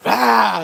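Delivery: mock startled shout; startled yell. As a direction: yelp as if a masked man leapt out. Man scared by mask.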